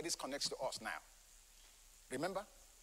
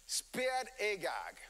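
spared Agag said very slowly; slow emphatic; much slower than usual. Shown as a demonstration. Preacher slowly driving home spared Agag. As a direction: drag out spared Agag for weight. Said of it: "don't forget the emphasis".

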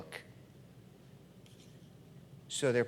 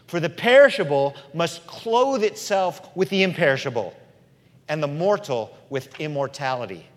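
loud, swelling pulpit proclamation; preaching; 5 semitones higher, 10 dB louder than usual. Acted. Preacher proclaiming scripture, loud and swelling. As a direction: proclaim it to the congregation.

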